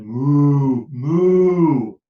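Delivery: long lowing moo; cow moo. A narrator's reading. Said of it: "The cow said".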